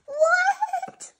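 very high laughing what; laughing disbelief. Acted. Lily told Tiger was a nickname, high laughing what.

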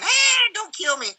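sudden high squeaky plea, hammed-up; mock pleading. Civilian tied up, begging his captor in a mock panic. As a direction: beg for your life in a jokey panic.

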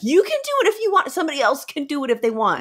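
quick and high, brushing it off; fast dismissive. Retold or imitated. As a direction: rattle it off to dodge the job. Voicing Crocus's dismissal, fast high.

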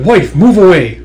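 loud, high order; acted commanding shout. Warlord ordering the wife aside, commanding shout.

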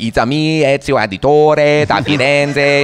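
high, loud cartoon Italian; hammed-up Italian accent. Actor mocks how the protagonist might sound, exaggerated Italian accent. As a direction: ham up a caricature Italian accent. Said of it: "what you want your protagonist to sound".

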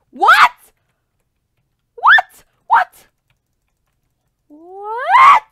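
high piercing disbelief; panicked shriek; shocked screams. Real, not acted. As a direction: scream as it all goes wrong. Shock repeated.

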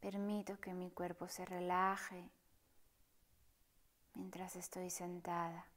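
soft, partly whispered meditation voice; hushed calm guide inviting stillness; guided meditation. Acted. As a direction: guide them gently through the breath, unhurried. Leads a breathing meditation.

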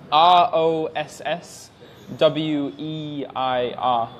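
each letter slow and apart, one by one; slow spelling. Shown as a demonstration. Teacher re-spells his Facebook name slowly for a viewer. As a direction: spell it out slowly so chat can catch it. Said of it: "Can you repeat it?".

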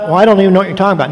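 rushed, offhand denial; fast brush-off. Shown as a demonstration. Teacher contrasting Peter's first casual denial, fast. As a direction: throw it away quickly. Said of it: "Now it's".

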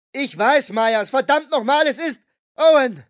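put-on desperate young woman shouting; Julie screaming. A narrator's reading. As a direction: scream a warning at him. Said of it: "brüllte Julie".

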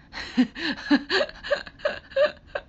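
high squeaky burst of laughter; a shrieking giggle over a silly nickname; squealing giggle. Real, not acted. Streamer giggling at fans' nickname, high squeal.

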